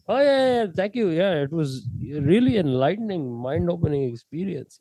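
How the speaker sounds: high-starting mock Indian accent; grateful gushing in a fake Indian accent; put-on Indian accent. Prankster thanking a shaman after meditation, in a put-on Indian accent. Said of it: "I did switch back to American for, like, one sentence".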